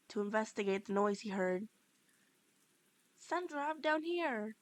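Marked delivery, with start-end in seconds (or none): 3.2-4.6 higher, calling up to someone above; a pitched-up call upward. Acted. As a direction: call him down from the stage. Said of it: "you yell up at him".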